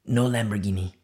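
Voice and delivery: ordinary delivery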